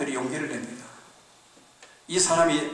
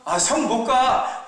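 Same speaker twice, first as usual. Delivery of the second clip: refusing; 10 semitones higher, 7 dB louder, much slower than usual. Acted. Younger brother refuses, laughing.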